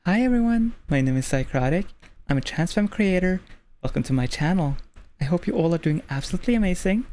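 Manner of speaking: lightly bright video intro read. Shown as a demonstration. Demo of her stream intro, upbeat host read. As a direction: greet viewers like opening a video. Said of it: "So before every stream".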